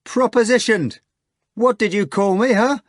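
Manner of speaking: high loud mocking yell. Natasha yelling at the hog, high and loud. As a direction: yell it into his ear. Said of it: "she yelled".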